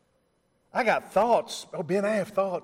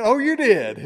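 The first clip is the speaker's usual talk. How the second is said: mock surprise; 6 semitones higher, 7 dB louder than usual. Surprised reply in retold dialogue.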